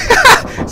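short, high burst of laughter. Real, not acted. Watcher laughing at a lame greeting, short laugh. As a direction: crack up briefly and loudly.

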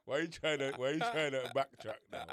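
loud laugh, then laughing words; laughing teasing. Real, not acted. Laughing at his friend backing out, loud. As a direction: tease him through a big laugh.

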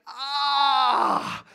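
long, loud groan of dismay; acted-out pained ah; painful groan. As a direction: groan at the checkout total. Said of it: "the painful effects of inflation".

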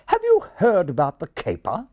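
high-pitched refined English; refined, high English pigeon voice; refined English accent. Acted. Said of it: "with a particularly refined accent".

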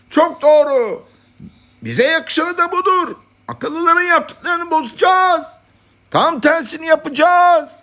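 very high-pitched shouted assent. A narrator's reading. Madmen scattered through the congress, shouting approval. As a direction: cry out like a fired-up crowd. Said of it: "Yer yer sesler yükseldi".

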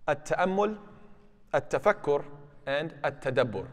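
careful Arabic pronunciation of the three terms. Shown as a demonstration. Teacher introducing three Arabic words, careful Arabic pronunciation. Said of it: "three words in the Arabic language".

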